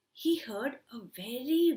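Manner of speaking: usual speaking voice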